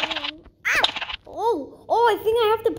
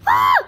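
high, loud yelp; short, high cry of alarm; startled scream; 8 dB louder than usual. Real, not acted. Player startled mid-game, startled scream.

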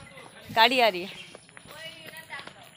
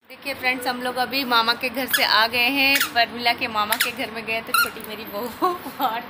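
ordinary talk

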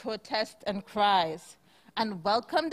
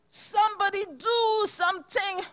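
high pleading cow voice; begging cow; 9 semitones higher than usual. Acted. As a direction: beg for help, pitched high. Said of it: "begged the cow with a moo".

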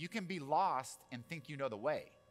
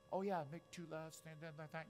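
higher, softer, vague rambling; mock clueless local giving directions; rambling directions-giver; 4 semitones higher, 8 dB quieter than usual. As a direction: ramble as if you know the way. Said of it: "They were just rambling".

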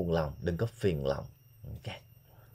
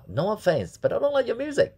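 example line; 14 semitones higher, 3 dB louder than usual. Shown as a demonstration. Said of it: "Ví dụ như bạn nói là".

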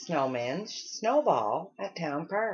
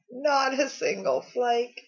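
sobbing; 6 semitones higher, 4 dB louder than usual. A narrator's reading. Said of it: "sobbed the Weatherman".

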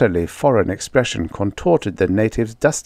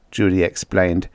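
ordinary delivery, nothing marked

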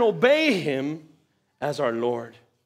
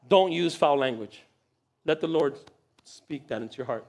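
normal talking voice

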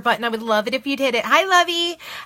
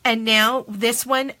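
normal talking voice

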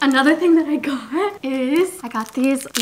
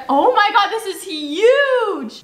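excited; 8 semitones higher, slower than usual. Real, not acted. Unboxing, thrilled.

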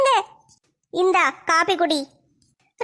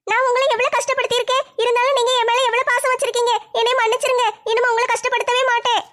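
higher, apologetic younger sister; a lightly tearful kid sister, pitched up; younger sister pleading; 6 semitones higher than usual. Acted. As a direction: beg forgiveness as the little sister.

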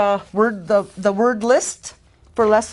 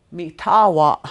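Mi tawa with tawa hit louder, falling; accentuated; 5 dB louder than usual. Shown as a demonstration. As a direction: lean into tawa in Mi tawa. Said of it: "accentuating it just a little bit more".

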